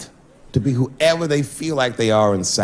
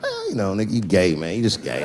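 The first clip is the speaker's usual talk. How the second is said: mumbling; 6 semitones lower, 4 dB quieter than usual. Retold or imitated. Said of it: "mumbling shit throughout this conversation".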